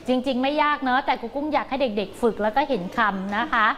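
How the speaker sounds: normal talking voice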